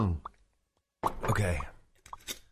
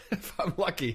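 laughing; 5 semitones higher than usual. Real, not acted. Laughing at own predicament.

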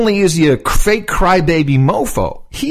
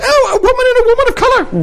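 high, fast sarcastic echo of the phrase; mocking echo; faster than usual. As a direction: parrot it back much higher and faster, sneering. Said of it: "mocking echo of Mitchell".

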